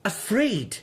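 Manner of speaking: breaking into alarm; the fear spills out on the last words; rising panic. Acted. Suspect repeats, rising toward panic.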